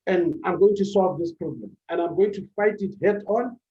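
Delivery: ordinary talk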